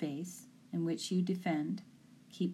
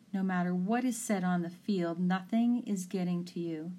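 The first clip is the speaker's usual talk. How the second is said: ordinary delivery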